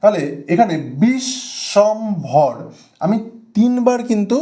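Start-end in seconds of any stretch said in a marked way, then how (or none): 1.0-2.5 "Bishwambhar" slow, broken bish, shom, bhor; three stressed beats. Shown as a demonstration. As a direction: stress and separate each syllable of "Bishwambhar". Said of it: "তিনবার আমাকে কিন্তু থামতে হলো". ("বিশ্বম্ভর" তিন দলে জোর দিয়ে, ধীরে)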